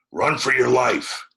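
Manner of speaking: loud, high shout to flee; urgent cry. A narrator's reading. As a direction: yell at him to run for his life. Tom fleeing, urgent cry.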